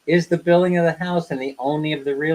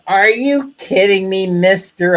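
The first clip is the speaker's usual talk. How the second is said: raised, louder retold protest; a hint of outraged customer; mock-indignant retort; 6 semitones higher, 5 dB louder, slower than usual. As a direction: play up the annoyance at the clerk. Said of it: "I was a little bit perturbed at that".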